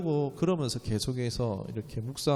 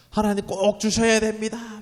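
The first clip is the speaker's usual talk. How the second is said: higher, louder mock plea to God; desperate pleading prayer; 9 semitones higher, 5 dB louder than usual. Pastor mimics a desperate believer praying, higher and louder. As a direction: beg God like a zealous believer. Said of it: "강청하는 기도 있잖아요".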